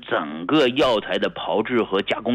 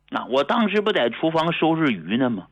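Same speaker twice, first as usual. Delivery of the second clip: ordinary talk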